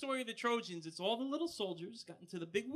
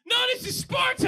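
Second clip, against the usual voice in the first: high, loud hammed-up movie battle cry; mock Sparta yell; 12 semitones higher, 11 dB louder than usual. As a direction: roar it like a Spartan king. Sparta movie quote shouted.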